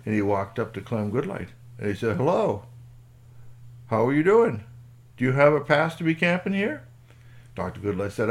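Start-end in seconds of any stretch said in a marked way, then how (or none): 2.1-6.8 loud, higher official greeting; booming ranger on his rounds; park ranger. Acted. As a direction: hail a camper and check their pass. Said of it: "the park ranger in his truck".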